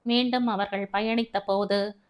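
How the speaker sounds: ordinary delivery, nothing marked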